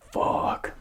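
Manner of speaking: voiceless, dismayed swear; whispered curse. Retold or imitated. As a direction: let the curse out under your breath. Retold dismay at hearing the terms.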